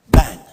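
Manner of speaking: a sudden loud crash sound; a punch landing, shouted out; loud imitated bang. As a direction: shout the impact noise "bang". Sledgehammer hit his chest.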